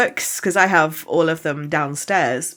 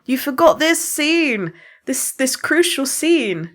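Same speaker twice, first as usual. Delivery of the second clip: mock screaming at the TV; 11 semitones higher, slower than usual. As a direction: shout it at the TV in disbelief. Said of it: "screaming at the T V like".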